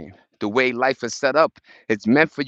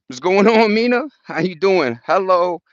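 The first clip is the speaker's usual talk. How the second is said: excited greeting; 6 semitones higher, 4 dB louder than usual. Real, not acted. Calling out greeting to Mina.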